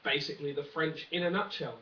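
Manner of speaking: ordinary talk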